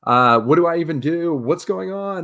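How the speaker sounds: high, loud excited babble; freaking out. Retold or imitated. Retelling the call-up, high and loud excited panic. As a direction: panic with pure joy. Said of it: "I'm like".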